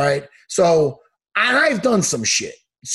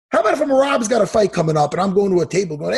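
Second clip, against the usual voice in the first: usual speaking voice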